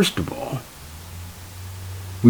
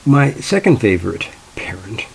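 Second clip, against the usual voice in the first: ordinary talk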